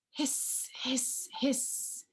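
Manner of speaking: whispered hissing; breathy snake-like hiss for the children. Retold or imitated. Imitating snake sound.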